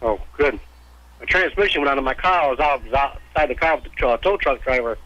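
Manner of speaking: ordinary talk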